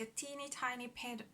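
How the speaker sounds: ordinary delivery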